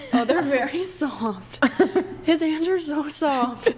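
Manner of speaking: high, laughing amazement; laughing surprise. Real, not acted. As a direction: giggle in disbelief. Feeling his hands, amazed.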